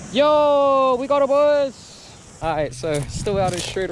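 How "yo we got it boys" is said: higher, slow triumphant call; excited. Real, not acted. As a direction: shout it out, thrilled. Finds lost quad.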